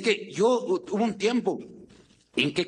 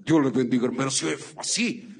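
voz de viejito; 6 semitones lower than usual. Acted. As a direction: lower put-on old man voice. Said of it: "Hay de viejito que sí". (voz fingida de viejito, más grave)

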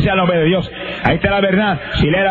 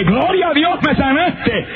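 much higher, bursting praise; joyful outcry; 10 semitones higher, slower than usual. Retold or imitated. Healed man cries thanks to God on waking, joyful outcry. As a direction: cry out in sudden amazed joy.

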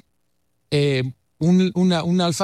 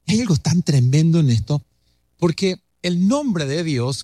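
ordinary talk

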